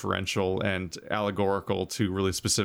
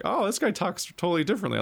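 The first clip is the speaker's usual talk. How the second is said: mock delight; 11 semitones higher than usual.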